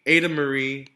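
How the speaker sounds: a calmer, firm repeat of the name. Acted. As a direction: warn her, a notch down. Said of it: "her parents yelled".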